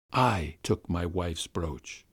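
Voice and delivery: usual speaking voice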